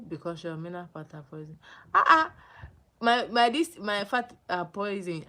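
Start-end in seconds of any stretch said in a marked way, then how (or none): 1.9-5.2 loud shocked cry, then slower; loud indignant exclamation; indignant outburst. Real, not acted. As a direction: burst out in disbelief. Reacting indignantly to viewer comment.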